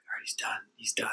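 hushed whisper while hiding. Retold or imitated. Hiding behind the shower curtain, tense whisper. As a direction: whisper so nobody hears.

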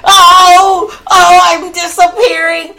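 high, loud wailing witch impression, mocking; hammed-up melting witch. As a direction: ham up the dying witch's wail. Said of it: "She's like".

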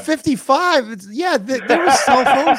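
high, mock indignant protest; mock offended. Real, not acted. Mock offended about his age.

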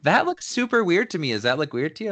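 high, bright valley girl impression of his wife. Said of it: "Not that my wife talks like a valley girl".

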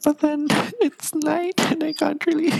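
very high squeaky talk. Shown as a demonstration. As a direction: keep talking in a high squeak. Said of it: "I'm trying to make a squeaky voice".

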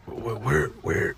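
soft, bewildered mock mumble; Norman Reedus confused. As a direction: look around lost and murmur. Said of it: "Norman Reedus".

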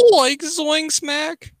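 very high, slow cartoon voice; Shaggy. Goofing with friends, high drawn-out cartoon impression. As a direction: pitch it way up and drag it out. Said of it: "pretty proud of my Shaggy impression".

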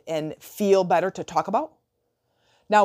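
ordinary talk, nothing marked

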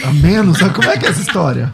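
real amused surprise, half laughing; laughing disbelief. Real, not acted. Host reacting to a joke about losing an ear, laughing.

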